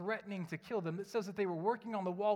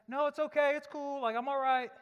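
higher and louder, a hurried no thanks; forced brush-off; 8 semitones higher, 7 dB louder than usual. Retold or imitated. Refusing his wife's coffee while trying to pray, higher and louder brush-off. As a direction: wave her off fast, pitch jumping up.

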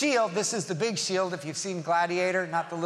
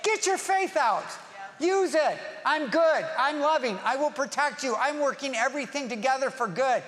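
high, raised voice of command; forceful exhortation; 6 semitones higher than usual. Acted. Jesus answering a worrier, urgent and forceful. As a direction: call it out with urgent conviction. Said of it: "what would Jesus say?".

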